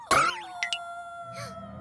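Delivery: sharp gasp of alarm; high startled gasp. Acted. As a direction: gasp as if something moved. Hears a strange sound.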